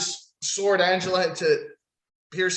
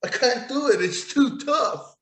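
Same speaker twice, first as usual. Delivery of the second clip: high, slow whiny voice straining at the sword; mocking Madcap Moss straining; mock whiny struggle; 6 semitones higher, slower than usual. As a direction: play a feeble guy who can't pull it out. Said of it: "like it's fucking Disney World".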